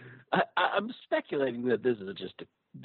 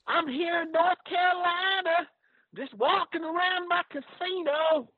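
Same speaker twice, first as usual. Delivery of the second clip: a fake Southern good ol' boy voice; southern accent. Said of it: "trying to do his southern accent".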